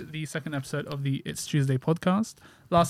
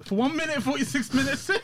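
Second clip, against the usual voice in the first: laughing; 10 semitones higher than usual. Real, not acted. Laughing at the long delay.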